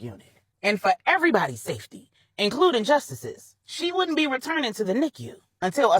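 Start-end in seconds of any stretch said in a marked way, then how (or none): none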